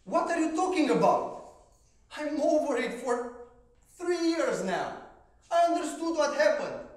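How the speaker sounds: loud, high, defensive yell; angry outburst. Retold or imitated. Shouting at his coach who is digging, furious. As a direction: snap back hard, offended.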